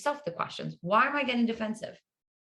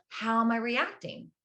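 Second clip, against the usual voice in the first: ordinary delivery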